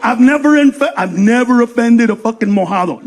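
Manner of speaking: ordinary talk, nothing marked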